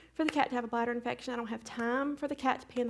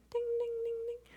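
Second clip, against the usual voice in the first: very high soft ding ding; playful bell sound; bell imitation; 13 semitones higher, 4 dB quieter than usual. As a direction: chime it like a little bell.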